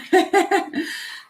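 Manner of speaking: high giggle. Real, not acted. Laughs at deboning chicken.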